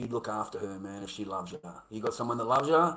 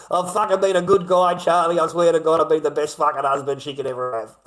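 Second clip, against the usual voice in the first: mocking the abuser; 5 semitones higher, 11 dB louder than usual.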